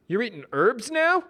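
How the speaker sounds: high, disbelieving question; incredulous. Acted. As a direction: ask it in disbelief. Brad voiced.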